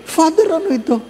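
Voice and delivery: high astonished voice; shocked question. Retold or imitated. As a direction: sound scandalized at the priest. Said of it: "Sabi ko".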